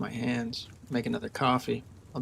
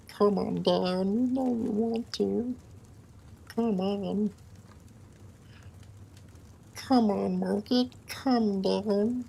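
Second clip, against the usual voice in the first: creepy creature coaxing the market, higher; higher Gollum-like coaxing; 6 semitones higher, slower than usual. Pretend. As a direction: lure the price down like a creepy little creature. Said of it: "doing the voice of that".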